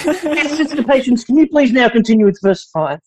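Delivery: mock church-class tone of voice; seminary teacher. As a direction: prompt a pupil with sugary formality. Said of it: "Do you remember the goddamn tone of voice?".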